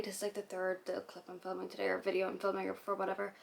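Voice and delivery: normal talking voice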